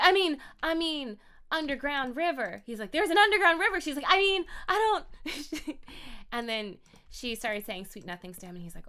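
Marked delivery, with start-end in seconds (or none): 0.0-2.6 high, loud, flustered Southern belle; flustered Southern twang; Southern accent. Acted. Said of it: "she's a little bit Southern". 2.9-3.8 high, loud disbelief; loud incredulous echo; astonished. Acted. Acted astonished reply. 4.1-5.8 high, slow, loud Southern belle; flustered, drawn-out Southern twang; Southern accent. Acted. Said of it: "she's a little bit Southern".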